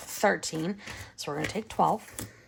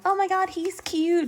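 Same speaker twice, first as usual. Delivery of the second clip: put-on much higher gushing girl voice; swooning girl; 12 semitones higher than usual. Imitating a teen girl gushing over a boy. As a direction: gush like a smitten teen girl.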